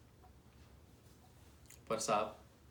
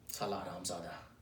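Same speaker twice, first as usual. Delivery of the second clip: low, soft swearing under the breath; muttered curse; 8 semitones lower, 11 dB quieter than usual. Acted. Clerk cursing his absent senior, muttered low.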